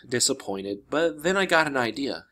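plain reading voice

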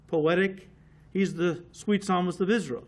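ordinary talk, nothing marked

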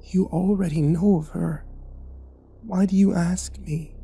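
put-on raspy old woman. A narrator's reading.

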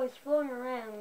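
ordinary talk

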